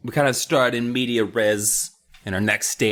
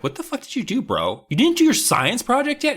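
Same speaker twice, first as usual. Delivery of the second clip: high, squawking mock disbelief; hammed-up shock at a lazy friend; incredulous friend; 13 semitones higher than usual. Margot scolding Amy over the late project, high mock disbelief. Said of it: "Margot is constantly just like".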